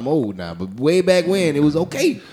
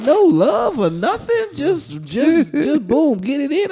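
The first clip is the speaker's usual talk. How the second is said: slow, high disbelief; high incredulous whine; 8 semitones higher, slower than usual. Retold or imitated. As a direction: stretch it out in disbelief. Incredulous in the bathroom.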